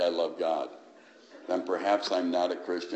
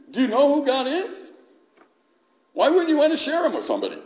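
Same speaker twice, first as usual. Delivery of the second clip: high, loud impassioned preaching; fiery preaching; 10 semitones higher, 5 dB louder than usual. Acted. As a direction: preach it out with full conviction. Preaching at full force.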